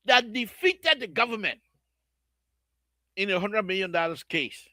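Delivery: usual speaking voice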